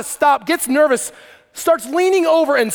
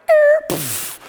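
high squeaky crash sound; mock crash noise. Dad imitating his son's bike wipeout with a high squeak. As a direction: imitate the kid wiping out.

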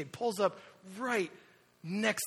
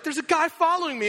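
panicked wife; 11 semitones higher, 7 dB louder than usual. Retold or imitated. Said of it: "And she's like".

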